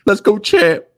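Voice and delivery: high, loud mocking cheer; high taunt. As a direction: shout 'Let's go, champ' high to tease.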